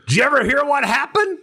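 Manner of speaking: high-pitched storyteller voice; Carl. Retold or imitated. Asking his visitor about the story, high and eager. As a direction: imitate an eager old buddy, voice up high. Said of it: "No, Carl talks".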